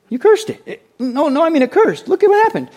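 high, loud mock astonishment; amazed disciple. Disciples marvel at cursed tree, high excited yelp. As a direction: blurt it out in hammed-up amazement.

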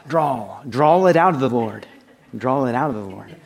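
mock country preacher drawl. Shown as a demonstration. Preacher jokes about claim-it teachers, exaggerated country drawl. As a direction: draw the vowels out in a country accent. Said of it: "you gotta have this drawl".

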